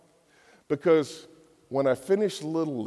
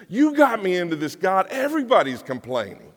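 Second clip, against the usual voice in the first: louder, higher exasperated cry to God; frustrated outburst; 5 semitones higher, 7 dB louder than usual. Acted. Moses venting to God about the people, louder and exasperated. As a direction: vent like a leader at the end of his rope. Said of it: "he got so frustrated".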